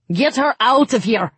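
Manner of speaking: loud, high, angry order; angry yell. A narrator's reading. City guard orders the twins to remove Alina, yelling angrily. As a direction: yell it to clear them out. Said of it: "one of them yelled angrily".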